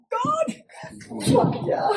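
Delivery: high squealing "god, fuck yeah" into a breathy laugh; high thrilled squeal with a laugh; squeal of delight. Retold or imitated. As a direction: squeal it like a giddy fangirl. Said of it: "I'm like".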